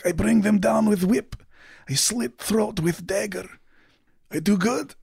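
put-on high-pitched, accented wounded uncle; dying fighter reporting in broken English; wounded uncle character. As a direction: speak haltingly in a high foreign-accented voice. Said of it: "He says".

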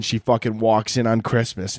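normal talking voice

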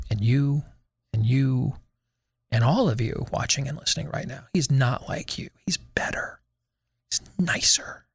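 soft, low, sinking into a whisper; mock confiding whisper. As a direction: drop down to a hushed whisper, as if confiding. Mockingly confides he is better.

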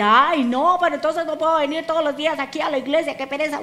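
fast, lightly acted grumble; mock reluctant excuse. Preacher mimicking members who skip service, mock reluctant excuse. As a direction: rattle off a lazy churchgoer's complaint. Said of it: "Ahora tú me vas a decir".

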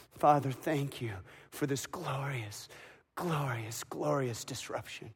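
soft, low, fading thanks; tender grateful prayer. Acted. Mary seeing her risen son, soft tender thanks.

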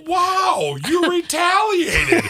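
high-pitched laughing mock outrage; slow, high mock indignation; mock-offended bully. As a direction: act wounded in a high, incredulous squeal. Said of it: "belly punches somebody over and over again and goes".

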